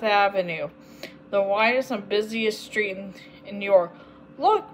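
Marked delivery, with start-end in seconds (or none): 4.4-4.6 higher exclamation; excited call. A narrator's reading. Agatha spotting the dog, calling out excitedly. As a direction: grab their attention.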